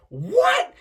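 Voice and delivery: high squeaky disbelief; stunned what. Pretend. Meeting his hero, squeaking in shock. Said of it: "I would be like".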